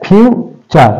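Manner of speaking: Future split into ফিউ, pause, চার; syllable by syllable. Shown as a demonstration. Teacher showing two syllables, future broken apart. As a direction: say future as two separate beats. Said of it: "ফিউ একটা".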